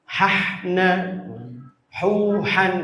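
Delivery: "Hahna, huhan" with forceful breathy h; pressed throat ha. Shown as a demonstration. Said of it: "Nekan, Bu". ("Hahna, huhan" dengan ha ditekan)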